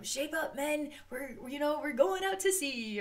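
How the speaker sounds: high, rallying call to the crew; ship captain. Pretend. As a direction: rouse the sailors like a hammy captain. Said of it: "it's sort of like I'm like".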